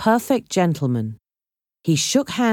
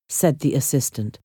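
ordinary delivery

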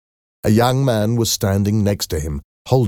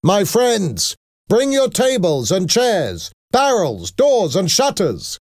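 high, forceful call to a crowd; rallying shout; 13 semitones higher, slower than usual. A narrator's reading. Young man rallying a crowd at the barricade, shouting. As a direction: call out each item, pitch held high. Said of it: "he shouted".

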